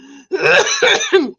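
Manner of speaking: real loud choking cough; harsh real coughs; coughing fit. Real, not acted. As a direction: hack after swallowing wrong. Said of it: "Swallowed wrong".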